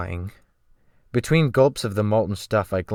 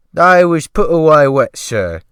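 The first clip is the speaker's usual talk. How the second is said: loud, high servile cook voice; fawning sea cook, pitched up and loud; ship's cook; 6 semitones higher, 11 dB louder than usual. Acted. The cook asking the rescued man, in a loud high fawning voice.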